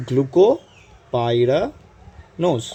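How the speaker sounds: glucopyranose drawn out very slowly in separate chunks; say-along pace. Shown as a demonstration. Teacher asking students to say it along, slow syllable-by-syllable delivery. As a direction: stretch each part for the class to follow. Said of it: "You should tell it with me".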